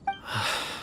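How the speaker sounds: soft troubled sigh; quiet exhale of frustration; weary sigh. Acted. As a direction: breathe out the helplessness. Troubled man unsure where to start.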